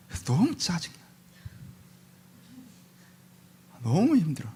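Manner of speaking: softly, worn out and fed up; weary complaint. Acted. Suffering Job complains of his exhaustion, weary and quiet. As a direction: let the voice sink in exhaustion.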